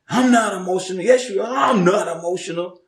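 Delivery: high-pitched back-and-forth squabble; mock bickering. Acts out an argument over emotions.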